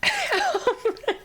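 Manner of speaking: shrill giggle burst; high squealing laugh. Real, not acted.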